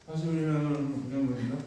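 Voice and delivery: perfunctory, monotone mumbling; half-hearted proclamation. Acted. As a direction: preach it without any effort. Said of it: "성의 없이 막 전하는 거죠". (성의 없이 웅얼웅얼 전하듯)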